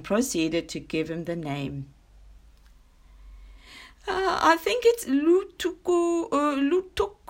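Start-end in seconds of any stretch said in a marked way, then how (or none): 4.1-7.2 high-pitched, loud, fumbling zebra voice; high, hesitant character voice, groping for the word; unsure zebra. Acted. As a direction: stumble over the name in a high voice. Zebra fumbles the forgotten name.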